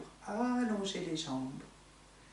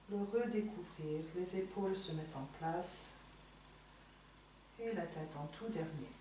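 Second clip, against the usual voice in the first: softer, fading gently to a hush; soft relaxing guidance; 10 dB quieter than usual. Real, not acted. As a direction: let the voice sink into calm. (voix douce qui s'éteint)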